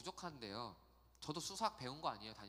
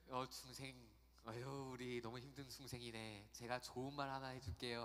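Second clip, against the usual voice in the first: imitating a monk; 3 semitones lower, 4 dB quieter than usual. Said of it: "스님이 그 불교의 교리를".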